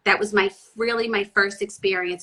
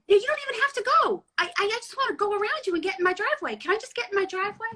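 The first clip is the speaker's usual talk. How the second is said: exasperated politeness; 13 semitones higher, faster than usual. Retold or imitated. Retold quarrel with truck driver.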